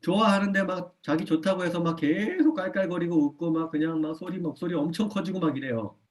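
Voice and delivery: ordinary delivery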